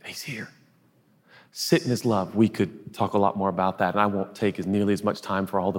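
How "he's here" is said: hushed whisper of comfort. Acted. Preacher whispering to the congregation that Jesus is near. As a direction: breathe it like a secret.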